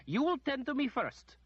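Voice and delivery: high, loud, haughty command with stress on will; a sour lady ordering the wizard; sour demand. A narrator's reading. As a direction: demand it sourly, leaning on will. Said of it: "came a sour voice from behind".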